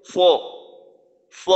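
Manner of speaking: ফ said heavy and thick; ফ made with a full, heavy sound. Shown as a demonstration. Teacher imitating someone who says ফ wrongly. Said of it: "ফাটাকে উচ্চারণ করার সময় এইভাবে করে".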